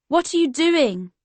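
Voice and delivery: high, loud shout over music; shouting at a friend over blaring music. Acted. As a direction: yell to be heard over the club. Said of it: "I shouted".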